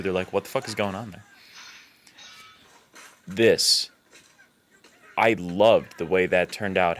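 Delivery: normal talking voice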